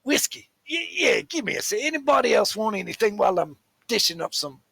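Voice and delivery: busy barkeep. Acted. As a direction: check with the customers while serving. Barkeep takes the drink orders.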